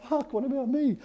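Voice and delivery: high-pitched indignant child's protest, retold; mock outraged squeal of being overlooked. Bitten boy protesting to his parents, indignant and high-pitched. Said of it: "And I'm like".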